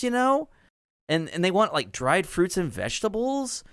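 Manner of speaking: high breezy gnome, constant "like"; valley girl gnome mayor. Acted. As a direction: tell it casually, like gossip. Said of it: "Bilby Bourbon Durbin says".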